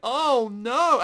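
high, loud "Oh, no" of heartbreak; mock dismay. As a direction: cry out as the scene lands. Reacting to sweet old man crying.

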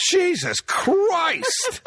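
high, very drawn-out exclamation; hammed-up yelp of shock; mock shocked outcry. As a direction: cry out like an actor just shot. Acts out shot movie actor.

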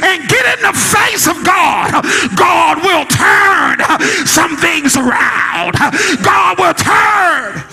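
high, driving pulpit exhortation; full-force preaching. Real, not acted. Preaches to a climax.

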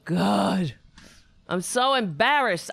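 ordinary talk

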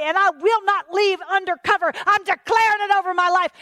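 loud, high preaching proclamation; bold declaration. Acted. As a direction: declare it at full force. Acts out bold declaration against temptation.

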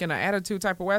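normal talking voice

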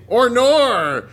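loud, high mock-Australian "oh no"; young Australian accent. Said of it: "younger Australian thing where they say".

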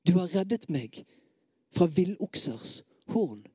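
pleading, emphatic scripture recital; impassioned psalm reading. Acted. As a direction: declaim the lament with urgent feeling.

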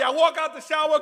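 usual speaking voice

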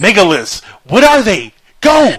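loud, rising quiz prompts; demo question to guest. Acted. Host mimics setting off a talkative guest, loud. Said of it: "ask him a question and just say".

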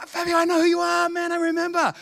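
high, held-out call; excited recognition. Retold or imitated. Preacher finally recognising his old acquaintance, excited. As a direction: act out suddenly placing an old friend.

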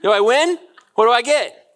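high, loud excited dog begging for approval; a pet asking its owner if it did good, high and excited; eager pet voice. Retold or imitated. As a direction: voice a tail-wagging dog wanting a treat. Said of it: "a dog or a cat or something that's like".

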